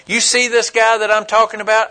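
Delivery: usual speaking voice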